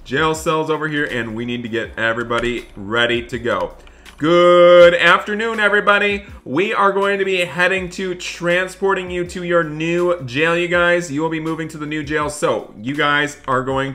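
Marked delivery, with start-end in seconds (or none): 4.2-9.1 announcing loudly. Pretend. Deputy greets prisoners over bus.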